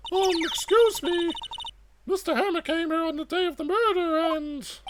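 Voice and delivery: put-on high-pitched, earnest girl sidekick; eager young sidekick. Voices Maya to Miss Vasquez.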